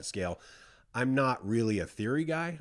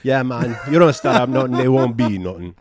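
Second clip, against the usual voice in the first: mock Jamaican; 4 semitones higher, 13 dB louder than usual. Reggae band bravado line.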